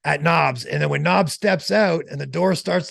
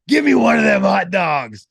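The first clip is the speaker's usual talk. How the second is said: loud imitated holler, falling away at the end; retold yell; 3 semitones higher, 6 dB louder than usual. Friend demanding a kid's hot dog, imitated yell. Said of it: "when he heard the yelling".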